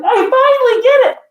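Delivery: put-on high, loud triumphant kid voice; imitating an elated daughter; excited little kid. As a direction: shout it like a child who cracked it. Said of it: "she was so excited".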